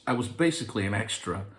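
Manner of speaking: ordinary talk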